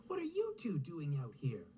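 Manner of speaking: plain reading voice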